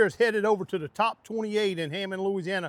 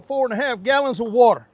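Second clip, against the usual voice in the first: usual speaking voice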